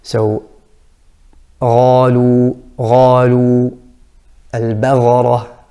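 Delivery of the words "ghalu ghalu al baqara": ghalu with a throaty gh instead of qaf; al-baghara with a gh; ghain error. Shown as a demonstration. Teacher imitating the ghain mistake. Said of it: "mixing it with ra".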